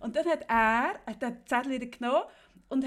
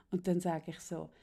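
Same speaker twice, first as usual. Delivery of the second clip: ordinary talk, nothing marked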